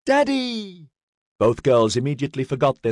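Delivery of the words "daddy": put-on high, drawn-out child's squeal; excited little girl. A narrator's reading. Daughters running over to father for hugs, excited squeal. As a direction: call out high in delight. Girls greet their returning father.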